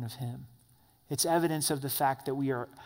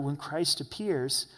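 ordinary talk, nothing marked

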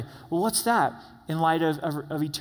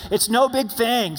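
louder, higher, slow and emphatic; said like you mean it; 7 semitones higher, 5 dB louder than usual. Shown as a demonstration. Pastor leading congregation in a bold declaration, loud. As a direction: declare it with full conviction. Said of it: "Say it like you mean it".

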